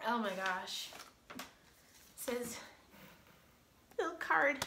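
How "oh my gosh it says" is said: ordinary delivery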